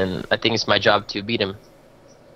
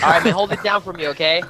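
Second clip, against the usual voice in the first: much higher, far put-on voice; high silly voice; 9 semitones higher than usual. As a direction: squeak it out playfully. Mock voice goodbye banter.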